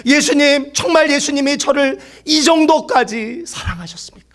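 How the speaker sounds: high-pitched imitated cry, fading at the end; retold crying out. Young convert overwhelmed by Jesus' love, imitated high cry. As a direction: act out crying to Jesus, high but not truly shouted. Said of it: "막 소리치면서 부르짖었대요".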